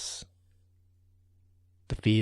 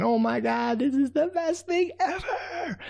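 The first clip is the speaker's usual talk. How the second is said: very high, squeaky little ant voice; tiny ant voice; 13 semitones higher than usual. Acted. Tiny ants answering the raccoon in squeaky little voices. Ant raves about sledding.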